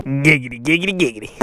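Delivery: low, put-on lecherous giggity; lecherous cartoon voice impression; Quagmire catchphrase.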